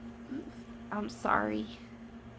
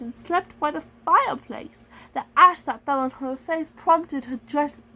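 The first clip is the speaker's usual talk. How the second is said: slow, lilting fairy-tale narration; storybook read-aloud; 4 semitones higher, 3 dB louder, slower than usual. Pretend. Narrating Cinderella for a read-along, lilting.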